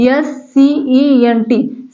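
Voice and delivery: slow letter-by-letter spelling of Saint; spelling out slowly. Shown as a demonstration. Teacher spelling Saint for students, slowly. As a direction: space out each letter. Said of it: "spelling out letters".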